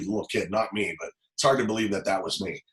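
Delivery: ordinary talk, nothing marked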